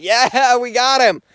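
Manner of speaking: high, loud re-enacted cheer of relief; imitating an overjoyed shout; retold relief. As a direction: relive the relieved cry, voice lifted. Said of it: "just crying of relief".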